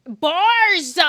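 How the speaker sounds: BARS blasted high and long; exaggerated shout. Shown as a demonstration. Streamer playing with a word, exaggerated shout. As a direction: bellow the one word.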